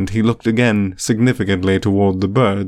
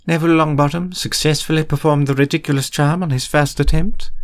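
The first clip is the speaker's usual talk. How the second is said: higher-pitched questioning professor; higher voice with an inquiring lift; lightly voiced professor questioning; 6 semitones higher than usual. A narrator's reading. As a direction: put it as a probing question, pitched up.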